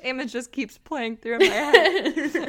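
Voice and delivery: breaking into laughter mid-explanation; laughing hard. Real, not acted. Storyteller pictures a friend skating, laughing hard. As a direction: explain it through growing real laughter. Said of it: "the reason why I'm laughing so hard".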